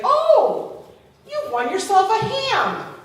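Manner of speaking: high, loud prize announcement; excited clerk. Retold or imitated. Checkout clerk to customer who won a ham, excited. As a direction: burst out with the good news.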